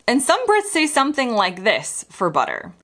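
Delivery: usual speaking voice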